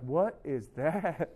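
low, drawn-out baffled question; mock disbelief. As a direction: stretch it out, appalled by what you hear. Acts reaction to students' music.

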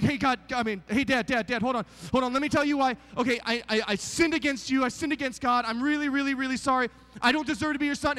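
high, hurried, apologetic plea; pleading son. Acted. Prodigal son begs his father for forgiveness, pleading. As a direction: beg the father to listen.

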